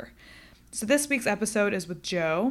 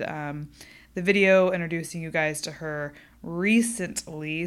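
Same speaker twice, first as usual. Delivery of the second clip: ordinary delivery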